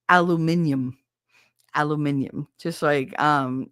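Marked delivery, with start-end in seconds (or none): 0.1-2.5 British "Aluminium", al-oo-MIN-ee-um. Shown as a demonstration. Streamer demonstrating the British aluminium for chat. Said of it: "say it the British way".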